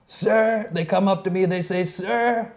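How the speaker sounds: high, loud, slowly drawn-out presidential boasting; hammed-up imitation of a president's anecdote; storytelling president impression. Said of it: "Every story he tells, he goes".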